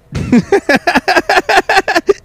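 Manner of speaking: high-pitched burst of laughter; real laughter. Real, not acted. Host cracking up at a deadpan clip, laughing hard.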